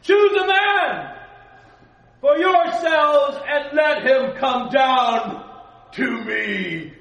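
slow, huge roaring giant voice at the top of the lungs; booming Goliath. Acted. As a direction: bellow the challenge like a giant warrior. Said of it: "it's better if I do it this way".

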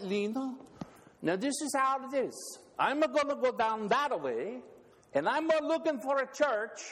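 high, put-on Scandinavian old man; Ole's voice. Preacher telling a joke, doing Ole explaining the church plan to Lena. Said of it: "and he says".

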